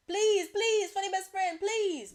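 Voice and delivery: high, loud begging in a hammed-up prayer; play-beg with each please pushed up high; mock pleading. Begging the Lord to be the funny best friend, mock pleading.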